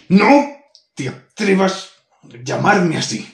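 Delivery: loud, high, slow furious roar; enraged boss roar. Acted. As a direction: roar the warning, stretching it out. Demon stamps, forbids nickname.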